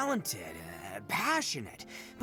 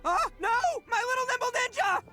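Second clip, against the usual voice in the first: very high, melodramatic cry of dismay; anguished wail; 4 dB louder, faster than usual. Acted. Owner sees his beetle lose, anguished wail. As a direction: wail for your fallen champion.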